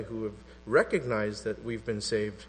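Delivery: ordinary talk, nothing marked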